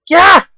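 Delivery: high, loud yelp of surprise; sudden "Gah!" as if jabbed from behind; short shocked shout; startled yell. Acted. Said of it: "yelling out in surprise".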